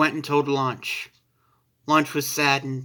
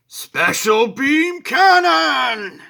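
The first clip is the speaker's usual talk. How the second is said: loud, high, drawn-out battle cry; stretched high shout of the special attack; 10 semitones higher, 6 dB louder, much slower than usual. Acted. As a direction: yell the move name slowly as you fire. Piccolo fires his attack.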